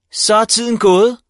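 teacher shouting that the time is up; triumphant shout. A narrator's reading. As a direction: announce the end like a winner. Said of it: "råbte Ditte K".

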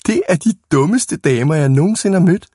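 high, crowing exclamation; gleeful calling out. A narrator's reading. Boy to his friends about the fooled ladies, gleeful cry. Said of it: "råbte Jakob".

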